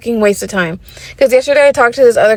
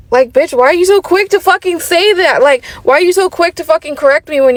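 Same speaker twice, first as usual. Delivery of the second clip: exasperated rant; 6 semitones higher than usual. Real, not acted. Ranting about the guy's correction.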